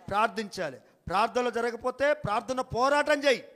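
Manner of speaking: ordinary delivery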